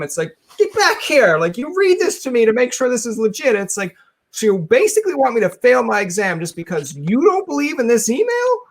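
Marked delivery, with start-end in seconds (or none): 0.6-3.6 mock demanding. Friend stopping someone leaving for an exam, mock demanding. 4.3-8.6 high-pitched, disbelieving protest; mock incredulous. Exam-taker protesting to a doubting friend, mock incredulous. Acted quarrel over the email.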